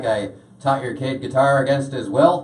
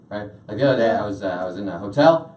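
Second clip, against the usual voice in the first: ordinary talk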